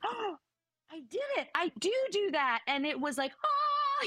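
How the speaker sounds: light bulb moment, mock amazed; imitating sudden realization. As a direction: daughter catching herself, mock amazed realization. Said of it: "you saw the light bulb".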